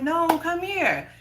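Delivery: high, drawn-out call back; slow, high-pitched mock beckoning; imitated man beckoning. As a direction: imitate a man calling someone over. Man calls her back, retold.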